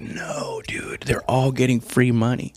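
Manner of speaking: mock conspiratorial whisper; whispered reply. Imitating Crenshaw whispering to Rogan, secretive. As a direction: hush it like a secret. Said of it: "He was like".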